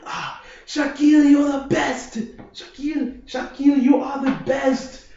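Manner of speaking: high, loud cheering chant of his name; cheering French friends. Retold or imitated. French friends praising him, loud high cheering. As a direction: hype him up like an adoring crowd.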